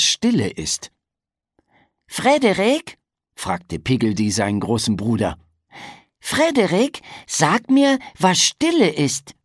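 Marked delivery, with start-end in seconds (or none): 2.1-3.0 put-on high childlike call to big brother; piping little-pig voice, questioning. Little Piggledy calls his big brother, high and piping. 6.2-9.3 put-on high childlike pleading question; piping little voice, eager to know; little brother asking. Piggledy begs his big brother to explain silence, high and childlike.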